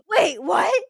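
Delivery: high, loud gasp of disbelief; real shock. Real, not acted. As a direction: gasp and cry out, stunned. Shocked at the Boston news.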